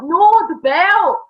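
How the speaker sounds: "No, the belt" drawn out, high and loud; emphatic correction. Retold or imitated. Setting Jayla straight about the belt, emphatic correction. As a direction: stretch "No" and "belt" in exaggerated correction.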